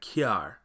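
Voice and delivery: "kiar" said as kyar with a Y glide after the k; Patois kyar. Shown as a demonstration. Teacher showing learners the Jamaican "car". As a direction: Jamaican "car" with a heavy Y sound. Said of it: "would sound like kiar".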